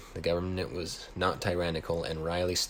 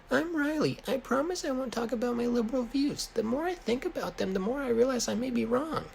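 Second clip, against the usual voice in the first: Riley's voice. A narrator's reading. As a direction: squeak it out way up high like a nervous kid. Riley voiced in story dialogue.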